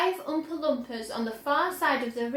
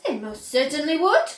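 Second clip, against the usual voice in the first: indignant cry; 3 semitones higher, 7 dB louder than usual. A narrator's reading. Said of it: "cried Mister Gloop indignantly".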